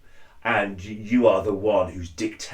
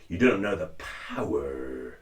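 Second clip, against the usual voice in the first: lower, softer Vader line ending in "er"; Vader demo; 5 semitones lower, 5 dB quieter than usual. Shown as a demonstration. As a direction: lean into the "er" at the end of power. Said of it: "there's this "er" at the end".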